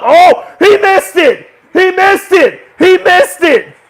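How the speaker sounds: high, loud disbelieving shouts; stunned fan shouting at the TV; shocked yelling. Real, not acted. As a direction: yell it at the screen as the kick misses. Live reaction to a missed kick.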